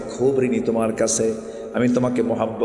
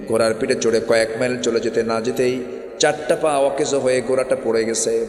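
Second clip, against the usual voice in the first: usual speaking voice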